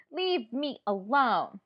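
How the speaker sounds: slow, emphatic angry demand; mad. Shown as a demonstration. Teacher demonstrating a mad voice for the class, angry. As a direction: drag out each word in anger. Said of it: "I sound mad".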